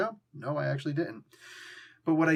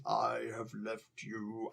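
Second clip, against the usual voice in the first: low, soft, slow shamed groveling; Apocalypse voice; 4 semitones lower, 8 dB quieter, much slower than usual. Retold or imitated. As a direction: mumble it like a broken god in sorrow. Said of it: "I can't even do in that voice".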